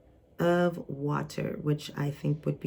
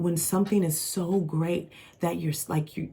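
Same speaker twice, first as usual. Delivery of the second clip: normal talking voice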